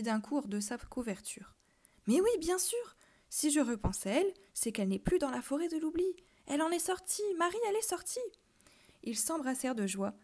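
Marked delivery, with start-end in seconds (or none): 2.1-8.2 high, bright burst of relief; boy cries out in sudden joy; joyful exclamation. A narrator's reading. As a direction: leap up with the realisation. Tomek leaps up with joy.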